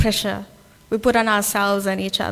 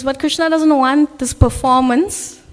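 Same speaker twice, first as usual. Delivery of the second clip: ordinary talk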